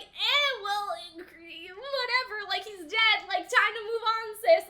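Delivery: sassy, uncaring put-down; bad attitude. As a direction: imitate someone loudly brushing it off. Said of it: "he just has such a bad attitude".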